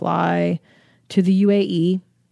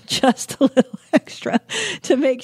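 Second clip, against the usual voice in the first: laughing; 6 semitones higher than usual. Real, not acted. Laughing about the bribe.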